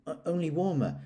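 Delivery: Piglet, hurried; small piglet. Acted. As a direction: blurt it out like a tiny pig. Piglet explains.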